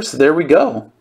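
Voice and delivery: usual speaking voice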